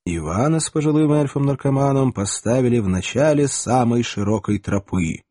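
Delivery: plain reading voice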